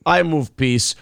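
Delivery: slow, mock Russian explanation; Russian accent. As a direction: lay out rules slowly in comic Russian English. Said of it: "you can do a Russian accent".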